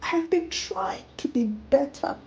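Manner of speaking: high, sobbing plea; a mother breaking down in sobs; crying apology. Acted. Mother begging her children's forgiveness, sobbing. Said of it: "she cried".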